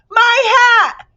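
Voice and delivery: very high loud cry; outraged shout. A narrator's reading. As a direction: howl over the hat. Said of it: "shouted the bug".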